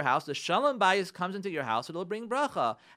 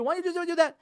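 high-pitched imitation of an exasperated husband; upset husband; 12 semitones higher, 4 dB louder, faster than usual. Husband directing his nearly crying wife at the wheel, imitated exasperation. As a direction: nag like a frustrated spouse. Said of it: "And he's upset".

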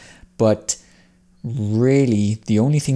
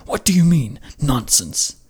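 indignant father; 5 semitones higher than usual. Acted. Old father challenging his son's word, indignant. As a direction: pitch jumps on what, falling on nonsense. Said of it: "Hidetora says".

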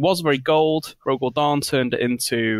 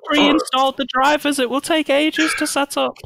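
high, put-on guardsman voice; hammed-up high complaint; fretting guardsman; 14 semitones higher than usual. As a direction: fret theatrically at high pitch. Plays the guardsman.

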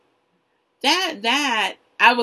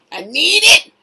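higher, louder plea; desperate cry; 8 semitones higher, 10 dB louder than usual. Retold or imitated. Craving the golden ticket, a loud high plea. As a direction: beg for it loudly. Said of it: "I'm like".

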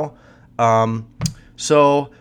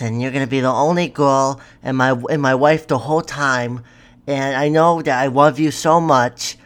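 put-on kid voice; 3 dB louder than usual. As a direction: gush like a smitten kid texting his girlfriend. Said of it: "I'm gonna do my kid voice".

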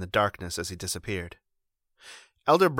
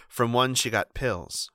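ordinary delivery

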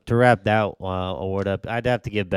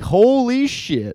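amazed; 13 semitones higher, much slower than usual. Retold or imitated. Reacting to game-winning pass.

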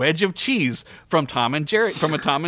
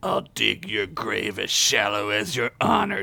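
lower, understated hero threat; smirking threat; 4 semitones lower than usual. A narrator's reading. Fighter answering his rival's insult, smirking low threat. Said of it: "Dan said with a smirk".

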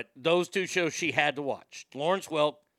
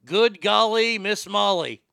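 loud, higher puzzle-solving shout; calling out answer; 5 semitones higher, 7 dB louder, slower than usual. Retold or imitated. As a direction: blurt it out like a game show contestant. Calls out solved puzzle answers.

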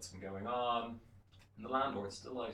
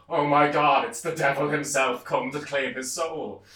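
loud, raised cry of mock terror; panicked mourners; 5 semitones higher, 15 dB louder than usual. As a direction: cry out in hammed-up alarm, drawing it out. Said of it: "the wakers go".

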